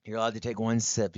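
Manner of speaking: ordinary talk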